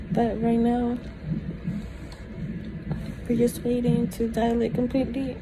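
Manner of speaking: usual speaking voice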